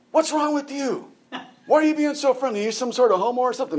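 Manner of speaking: high put-on voice of a suspicious old man; grumpy old man. Old man at the nursing home scolding the friendly preacher, mock suspicious. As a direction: act out the old gentleman's hostile question. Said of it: "And he goes".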